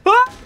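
short, loud high scream; sudden scared shriek; startled yelp. Real, not acted. Startled by a threat in game.

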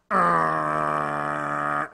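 low growling machine rumble; a low mechanical rumble; machine grind. Retold or imitated. Imitating coffee machine noise.

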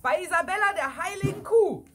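high, loud cry into the night sky; joyful shout. Acted. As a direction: shout it to the heavens, triumphant. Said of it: "und rief".

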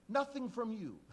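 high loud snub; loud retort. Acted. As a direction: fire back at the disciples. Blind man retorts.

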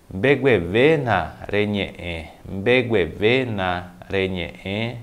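slow, drawn-out careful enunciation; slow model phrase. Shown as a demonstration. Teacher showing how to say "fale baixo", slowly enunciated. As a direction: stretch each word out for learners to copy.

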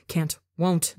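usual speaking voice

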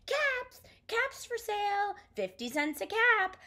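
high, sing-out vendor's cry; peddler's street call. A narrator's reading. Peddler to passers-by with caps to sell, calling out. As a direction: call out your wares in a high voice. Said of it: "he walked back to town calling".

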